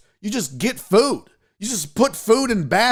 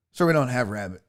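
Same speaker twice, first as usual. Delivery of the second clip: lower, softer, patient clerk voice; politely turning down an odd request; calm store clerk; 6 semitones lower, 3 dB quieter, faster than usual. Retold or imitated. Store clerk answering a lost customer, lower and softer.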